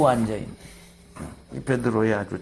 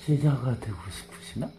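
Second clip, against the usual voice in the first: softer, sly taunt; sly teasing; 7 dB quieter than usual. Acted. Blind man teasing the Pharisees, softer and sly. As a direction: drop your voice and needle them. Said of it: "약을 팍 찔른 거야".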